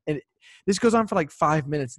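ordinary talk

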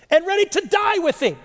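high-pitched incredulous jab at a past boast. Retold or imitated. Preacher recalling Peter's boast to the congregation, high-pitched and incredulous. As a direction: throw the old boast out, high and pointed.

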